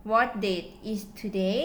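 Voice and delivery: slow model sentence. Shown as a demonstration. Teacher to students giving an example, very slow. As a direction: read it out slowly for learners to copy. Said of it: "ตัวอย่าง".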